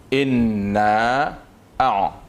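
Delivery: slow, drawn-out chanted Arabic recitation; Quranic recitation. Shown as a demonstration. Teacher chants a verse for students, slow recitation. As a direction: recite each word slowly for the students.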